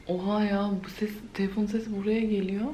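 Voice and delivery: hushed whisper to avoid waking others. Real, not acted. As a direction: keep it a whisper so nobody wakes. Sneaking around so others keep sleeping.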